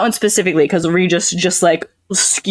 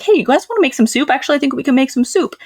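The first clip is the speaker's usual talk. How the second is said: cheery; 9 semitones higher, faster than usual. Retold or imitated. Voicing cheerful companions.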